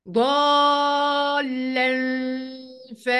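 "ضالا" very drawn out, ending in nasal hum; madd and ikhfa. Shown as a demonstration. Teacher isolating the rule for students, "ضالا" prolonged with ghunna. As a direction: stretch "ضالا" and hum the hidden nun into f. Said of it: "إخفاء حقيقي بغنة".

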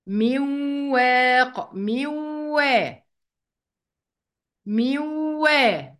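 very slow "من وا" as miwww-waa; nun merged into waw. Shown as a demonstration. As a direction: hold a long nasal w in "من واق". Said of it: "هكذا".

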